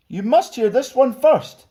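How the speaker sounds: lively, sing-song storybook reading; children's story narration. A narrator's reading. Stuart reading a picture book to children, lively. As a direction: read it like bedtime storytime for kids.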